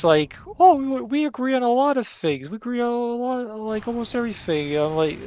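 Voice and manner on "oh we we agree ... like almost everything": high mocking imitation of the friend, singsong. Mocking a friend who claims they agree, high whiny. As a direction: mimic him in a high whiny voice. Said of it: "he says".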